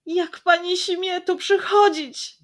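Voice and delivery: loud, high, hurt outcry; aggrieved shout. Acted. Jasiek confronting the lady, aggrieved shout. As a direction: cry out in bitter reproach. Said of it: "krzyknął rozżalony Jasiek".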